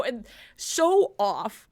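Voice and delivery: usual speaking voice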